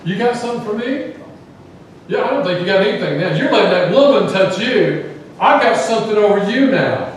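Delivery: high, loud, taunting sarcasm; sarcastic attitude. Retold or imitated. Simon taunting Jesus about the woman, loud sarcastic sneer. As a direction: throw it back in his face, smug and mocking. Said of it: "That's the attitude".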